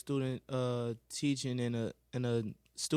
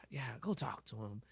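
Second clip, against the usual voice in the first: teacher whispering; 2 semitones lower, 8 dB quieter than usual. Retold or imitated. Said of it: "She was like".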